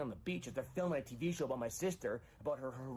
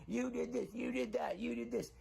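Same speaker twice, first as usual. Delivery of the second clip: mock accusing, higher pitched; a hint of a quarrel; 13 semitones higher than usual. Caller acting out a drunk couple's fight, lightly accusing. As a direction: jab each "you did" like a drunk spouse.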